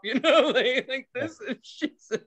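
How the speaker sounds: high, giggly, breaking up; laughing while talking. Real, not acted. Teller showing friend what she did, cracking up. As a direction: talk through real laughter.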